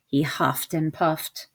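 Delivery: plain reading voice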